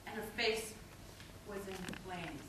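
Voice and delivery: low, very soft, halting; hushed horror. Acted. Poet describing her burning face, hushed. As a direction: let it sink almost to nothing. Grim image told in hush.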